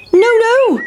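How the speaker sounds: very high, sing-out refusal; defiant pancake. Acted. Pancake to hungry boys, defiant.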